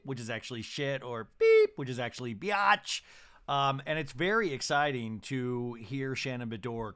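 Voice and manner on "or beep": high loud censor beep; sharp mock censor bleep; bleep imitation. Shown as a demonstration. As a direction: squeal it like a TV bleep. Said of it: "the beep".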